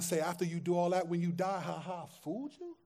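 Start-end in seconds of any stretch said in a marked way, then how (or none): 1.6-2.8 a soft mock taunt; mocking trickster God. Preacher voicing a trickster God, softly mocking. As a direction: sneer it like a cheat who tricked them.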